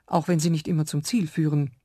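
ordinary delivery

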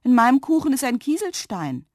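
put-on child's surprised outcry; astonished. A narrator's reading. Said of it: "sagte Lilli verwundert".

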